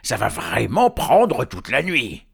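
loud, high, slow, weight on "vraiment"; angry troll. Acted. Hubert scolding Léon, loud exasperation. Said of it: "trois trolls en colère".

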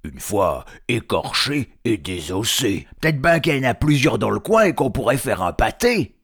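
scheming troll. Acted. Hubert planning a hobbit pâté, loud troll enthusiasm. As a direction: build up to an excited troll idea.

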